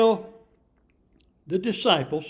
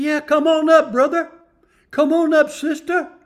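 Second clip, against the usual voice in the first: joyful loud invitation; 8 semitones higher, 8 dB louder than usual. Acted. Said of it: "He said".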